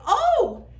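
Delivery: very high, loud cry of alarm; frightened cry. Acted. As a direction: yelp in fear at the huge snake. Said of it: "they cried".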